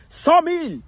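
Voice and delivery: mock game show call; counting call. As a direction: shout out a sum like a quiz host. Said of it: "il va dire".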